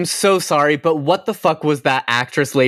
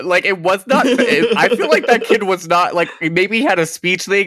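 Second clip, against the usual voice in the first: usual speaking voice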